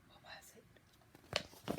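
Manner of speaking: slow, suspenseful whisper; hushed wondering. A narrator's reading. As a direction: whisper the question slowly. Narrator wonders about the small voice.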